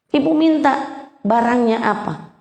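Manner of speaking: ordinary talk, nothing marked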